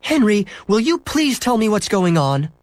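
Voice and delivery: high-pitched, insistent plea; exasperated mother. A narrator's reading. Mother pressing son for an explanation, exasperated. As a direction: demand an explanation in a high, fed-up voice. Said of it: "His mother was exasperated".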